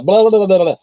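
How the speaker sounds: imitating the agent's rushed speech; mock gibberish. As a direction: babble it like the agent's fast talk. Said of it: "Yes, sir".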